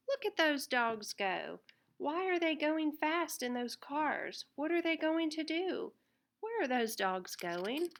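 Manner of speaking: ordinary delivery, nothing marked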